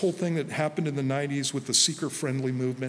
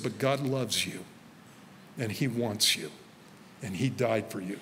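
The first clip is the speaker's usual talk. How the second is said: tender reassurance; 4 semitones lower, 5 dB quieter than usual. Retold or imitated. Retelling the preacher's loving words to a sinner, tender. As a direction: ease off and let the grace land gently.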